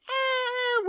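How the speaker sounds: high, noncommittal "eh"; dismissive shrug. Retold or imitated. Host admitting the dreaded event was fine, dismissive.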